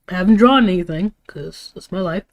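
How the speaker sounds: ordinary talk, nothing marked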